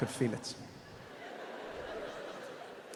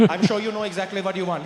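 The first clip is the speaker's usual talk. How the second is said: put-on Indian English, insistent; Indian accent; 3 dB louder than usual. Pushing Steve to order, insistent Indian accent. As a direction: urge politely in an Indian accent. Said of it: "You got a bit of an accent".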